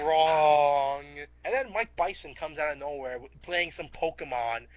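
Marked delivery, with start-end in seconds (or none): 0.0-1.0 loud, long drawn-out verdict; booming contradiction. Retold or imitated. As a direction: boom it out and hold the word.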